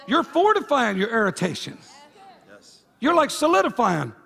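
loud, driving preacher cadence; full-force preaching. Acted. Pastor pressing the congregation about irritation, preaching loud.

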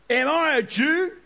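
high scornful retort. Acted. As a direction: fling it back, high and sharp. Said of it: "Pilate retorted".

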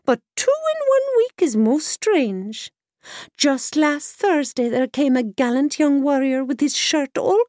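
put-on high, loud cackling old washerwoman. A narrator's reading. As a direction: pitch it up as a sly old crone. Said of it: "said the washerwoman".

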